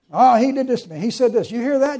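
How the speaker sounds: put-on loud, high-pitched childish complaint; whiny tattling. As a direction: tattle on someone like an indignant baby. Mimicking whining, finger-pointing babies.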